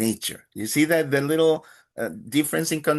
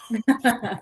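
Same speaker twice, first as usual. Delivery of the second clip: laugh; 8 semitones higher than usual. Real, not acted. Laughing at himself.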